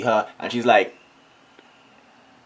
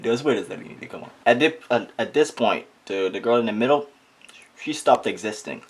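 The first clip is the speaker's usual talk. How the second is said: ordinary talk, nothing marked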